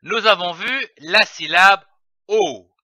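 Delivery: ordinary talk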